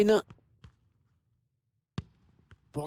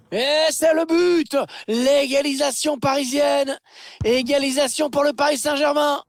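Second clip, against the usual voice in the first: excited sports commentary; 9 semitones higher than usual. Real, not acted. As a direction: roar out the equaliser. Commentateur crie le but. (cri de but surexcité)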